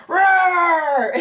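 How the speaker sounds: loud, high mock roar breaking into a laugh. Ripping his shirt off mid-fight, mock roaring with a laugh. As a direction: roar it, then crack up. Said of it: "grabs his shirt and is like".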